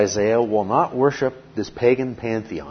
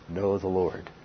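ordinary talk, nothing marked

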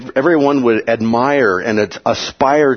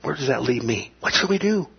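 soft, breathless panic; panicked worry; 7 dB quieter than usual. Retold or imitated. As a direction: fret under your breath, rising on the last words. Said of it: "near panic".

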